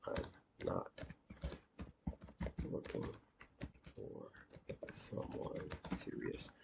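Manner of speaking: very soft, slow and broken up; halting, barely voiced repeat of a message; word by word. Real, not acted. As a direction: murmur it slowly with long gaps between words. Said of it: "say that again, uh, like she did".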